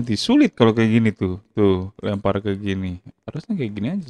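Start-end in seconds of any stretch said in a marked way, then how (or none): none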